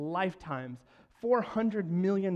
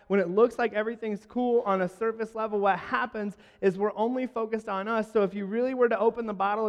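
louder, higher, fast preaching climax; preaching at full force; 5 semitones higher, 5 dB louder than usual. Acted. Pastor urging the church to look past themselves, fervent. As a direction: drive the point home to the congregation.

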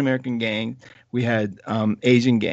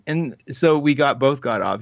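ordinary delivery

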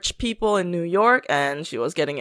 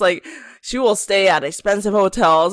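normal talking voice

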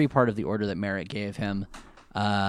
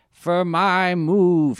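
slow, louder hypnotized drone; mind control voice; 6 semitones higher, 5 dB louder, much slower than usual. Retold or imitated. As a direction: drag each word like a mind-controlled puppet. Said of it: "mind control voice".

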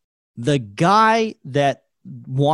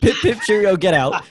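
laughing through a mock British send-off; laughing British accent; 4 semitones higher, 4 dB louder than usual. To friends, repeating the joke, laughing mock British accent. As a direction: echo the British line, laughing. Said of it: "doing these impressions".

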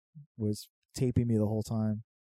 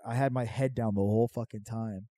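normal talking voice

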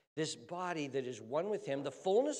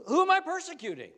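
high, fast, loud puzzled question; Saul; 14 semitones higher, 10 dB louder, faster than usual. Acted. Saul baffled by the accusation, high quick question. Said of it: "you know what Saul's next words are?".

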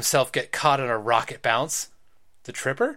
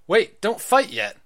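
urgent call; 6 semitones higher, 7 dB louder than usual. Acted. Boy stops the others.

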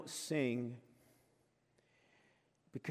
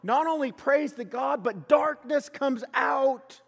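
loud, high preaching that slows on the last words; preaching at full force; 11 semitones higher, 9 dB louder than usual. Acted. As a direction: proclaim it to the congregation. Preacher opens with rising fervour.

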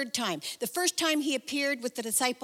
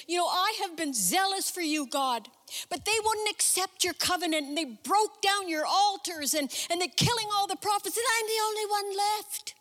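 higher, plaintive whining to God; whiny complaint; 9 semitones higher than usual. Pretend. Elijah complaining to God, high plaintive whine. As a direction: wail your grievances like a sulking prophet.

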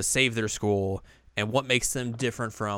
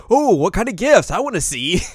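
much higher and louder, curious; eager high voice; 10 semitones higher, 9 dB louder than usual. Retold or imitated. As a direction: squeal it out with curiosity. Girls curious about gifts.

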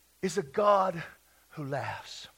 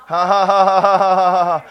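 receiving joy in laughter; 15 dB louder than usual. Real, not acted. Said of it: "I'm receiving it right now".

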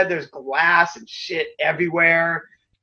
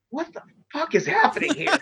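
high, incredulous what-the-hell; retold disbelief; 9 semitones higher, 4 dB quieter than usual. Wrestler amid backstage chaos asks what's happening, high incredulous disbelief. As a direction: voice leaps up in shocked disbelief.

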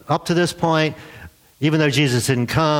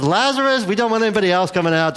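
singling out Lazarus; 7 semitones higher, faster than usual. Acted. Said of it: "He had to say Lazarus".

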